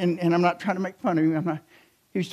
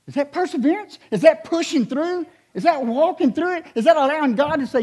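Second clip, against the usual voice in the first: high, building questions to the crowd; rhetorical preaching; 11 semitones higher than usual. Acted. Preacher asking the congregation about perseverance. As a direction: press each question harder.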